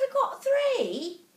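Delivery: high, slow put-on English accent; mimicked English accent. Answering the lady about her children, high put-on English accent. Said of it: "we're talking like this the whole time".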